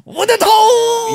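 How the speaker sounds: high, loud, slow laughing yell; real laughing shout; laughing outcry. Real, not acted. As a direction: burst out in amused disbelief. Laughing outburst at friend's story.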